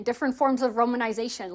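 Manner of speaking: normal talking voice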